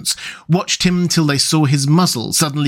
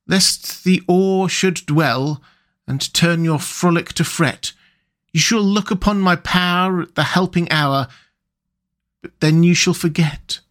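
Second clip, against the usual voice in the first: verse recital; 4 semitones higher than usual. Acted. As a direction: chant the words as if from far off. Rat reciting the reeds' song.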